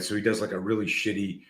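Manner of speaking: ordinary delivery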